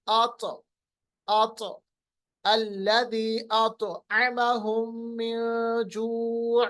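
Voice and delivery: slow recitation, bouncing त in अतअमहुम; qalqalah recitation. Shown as a demonstration. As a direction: echo the त of अत and draw out the verse. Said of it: "उसकी सिफत क्या है जब ये सुकून में होगा?". (धीमी तिलावत, अत पर क़लक़ला)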